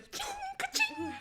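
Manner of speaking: high mouth-made clinks and hisses; imitated drink-serving noises. Recalling a drink-serving video for friends, high imitated sound effects. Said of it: "that video of the lady serving those drinks".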